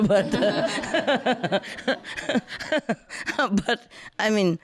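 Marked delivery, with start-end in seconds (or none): 0.0-3.5 laughing. Real, not acted. Laughing at own mistake.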